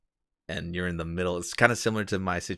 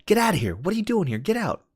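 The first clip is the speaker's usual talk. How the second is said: high-pitched, louder mock yell; voice from nowhere; 12 semitones higher, 5 dB louder than usual. Hidden man warning off a hunter, high mock yell. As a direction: shoo someone off in a high character voice. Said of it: "I hear a voice that says".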